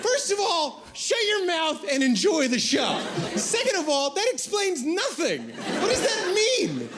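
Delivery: high, worked-up comic outrage; mock indignant rant. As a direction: get worked up and indignant at him.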